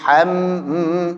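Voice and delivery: "ham" with the m held as a hum; flowing mim. Shown as a demonstration. Teacher shows the mim flowing, hummed "ham". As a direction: let the m of "ham" flow on. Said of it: "alirkan dikit".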